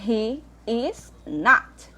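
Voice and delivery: "he is not" dragged out very slowly; slow model answer. Shown as a demonstration. Teacher modelling the long negative answer, very slow. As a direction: spell out the long form word by word. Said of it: "teacher models full answer".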